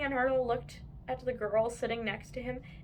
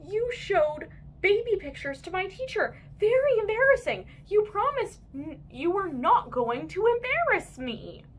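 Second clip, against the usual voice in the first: put-on upset boy; 9 semitones higher, 4 dB louder than usual. A narrator's reading. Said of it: "said Andrew".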